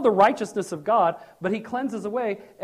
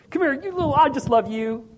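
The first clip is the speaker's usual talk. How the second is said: fond embrace; 5 semitones higher, 3 dB louder than usual. Pretend. God voiced hugging the sinner.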